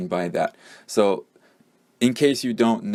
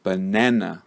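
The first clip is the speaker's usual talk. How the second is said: "banana" with the middle syllable na stressed and the schwas reduced. Shown as a demonstration. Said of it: "like that".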